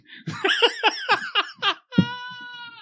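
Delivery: squealing laugh breaking up; high-pitched laugh. Real, not acted. Laughing while hosting.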